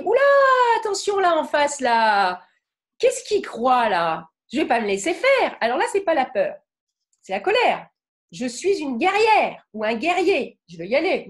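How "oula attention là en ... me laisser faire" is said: a brain bristling for a fight; angry inner voice. Shown as a demonstration. Teacher voicing her angry brain to the class, mock angry. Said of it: "quand on est en colère, mon cerveau, il me dit".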